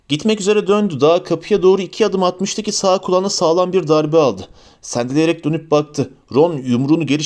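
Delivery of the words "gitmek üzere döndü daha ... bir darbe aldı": ordinary delivery, nothing marked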